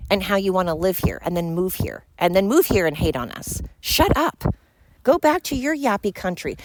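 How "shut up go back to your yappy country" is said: high snapped shut up; real exasperated outburst; angry shout. Real, not acted. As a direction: cut them off with a sharp high yell. Ranting at other countries.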